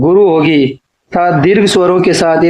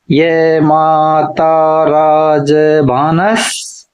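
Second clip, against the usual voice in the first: drawn-out chant of the formula, each syllable stretched; slow mnemonic recital; much slower than usual. Shown as a demonstration. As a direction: recite the memory formula very slowly for students to repeat. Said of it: "गणों को याद रखने का सूत्र है". (सूत्र को बहुत धीरे, खींचकर पढ़ना)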